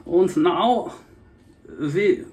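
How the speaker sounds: clipped German caricature; German accent. Retold or imitated. German accent, to viewers. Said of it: "Germans who live in Australia".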